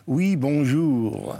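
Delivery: ton pointu French accent. Shown as a demonstration. Pied-noir showing how they answered the telephone, pointu accent. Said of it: "On prenait le ton pointu".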